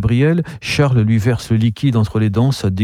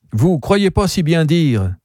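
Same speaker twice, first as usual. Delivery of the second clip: higher-pitched, keen exclamation; exclaiming; 7 semitones higher than usual. A narrator's reading. Gabriel retorting to the sceptical customer, exclaiming. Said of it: "s'exclama Gabriel".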